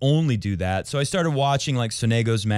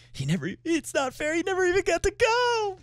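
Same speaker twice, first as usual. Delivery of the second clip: high, thin mocking whine; hammed-up high whiny complaint; mock whiny quote. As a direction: squeak it out like a sulking kid. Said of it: "said that guy from".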